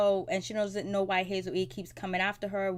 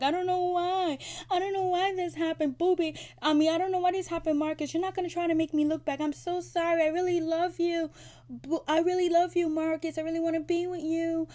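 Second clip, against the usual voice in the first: high-pitched, louder mock whine of a crying woman; hammed-up tearful pleading, pitched way up; mock crying Brooke; 9 semitones higher, 3 dB louder than usual. Brooke mocked as crying to Boobie and Marcus, high whiny fake sobbing. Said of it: "you start crying like".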